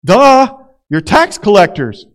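Jesus teasing his dinner guests; teasing duh. Retold or imitated. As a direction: rib them with a friendly duh. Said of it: "Jesus probably says something like".

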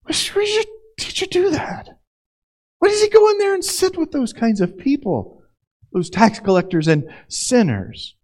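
high, puzzled onlooker asking why; high incredulous gossip's question; questioning disciple voice. Retold or imitated. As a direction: voice a scandalised outsider, pitched well up. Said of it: "and they said".

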